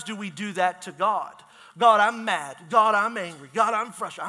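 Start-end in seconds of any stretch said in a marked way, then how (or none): 1.8-4.3 louder, frustrated complaint to God; venting prayer. Retold or imitated. Pastor imitating a believer venting anger at God. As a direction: vent at God like a grumbling friend.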